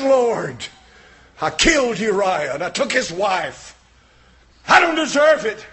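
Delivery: loud, high, shouting sermon; preaching at full force. Real, not acted. Preacher telling the congregation about David, roaring full-force preaching.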